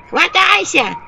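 high, squeaky nasty little voice; shrill little cartoon devil; cartoon imp voice. A narrator's reading. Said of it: "противный голосок ... из мультфильма". (противный мультяшный голосок)

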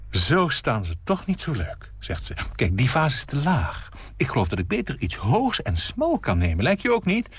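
fussy hostess. Acted. Nina ignoring the story to fuss over the vase.

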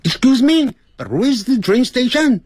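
a light Indian-accented question. Shown as a demonstration. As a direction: ask for the station in a playful Indian accent. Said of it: "这有点像印度人腔".

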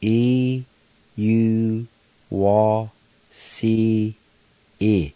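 E U W C E sounded out one syllable at a time; teacher sounding out E U W C E. Shown as a demonstration. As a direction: break E U W C E into separate syllables. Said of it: "Pronounce like this".

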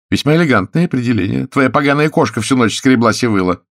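sarcastic, high and loud woman's voice; irritated actress. A narrator's reading. Actress complains to Oleg about his cat, irritated. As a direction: needle him as the annoyed lover.

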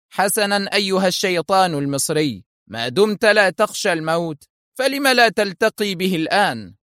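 mock him with cool contempt; a lightly voiced sneering enemy agent; sarcastic villain. A narrator's reading. Eli aims the gun.

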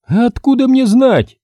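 раздражённый возглас высоко; ударение на «мне», высоко и громко; раздражённо. Acted. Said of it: "раздражённо воскликнула Галина Николаевна". (Галина Николаевна раздражённо отвечает мастеру)

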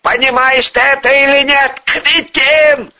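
high, loud, raging demand; exploding, high-pitched contempt; furious insult. A narrator's reading. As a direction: shout down an incompetent subordinate. Boss rages at subordinate.

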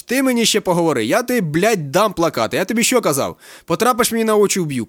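high, loud, threatening tirade; angry scolding. A narrator's reading. Captain threatens the student in his office, angry and loud. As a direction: shout him down like a furious captain.

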